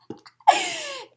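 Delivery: high squeal of laughter. Real, not acted. Laughing at address on package.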